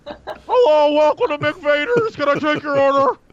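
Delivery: high put-on fast-food cashier; cheery drive-thru greeting in character; McVader drive-thru voice. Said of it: "Imperial burger".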